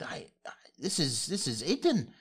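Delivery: a hint of a high, halting old woman; lightly voiced granny, lost for words; stunned old lady. Old lady recognizing the dying gnome, halting.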